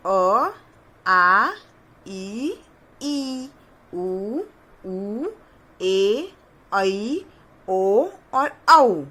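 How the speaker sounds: slow drill of अ to औ; vowel recitation. Shown as a demonstration. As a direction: recite each vowel for children to copy. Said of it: "इतनी मात्राओं का अभ्यास देख लिया है".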